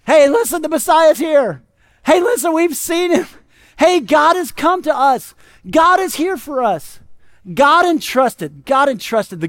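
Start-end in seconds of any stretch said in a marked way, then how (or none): 0.0-7.0 higher, louder, drawn-out announcement of great news; excited proclaiming. Acted. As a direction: play shepherds eagerly spreading the word. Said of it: "started going around telling people".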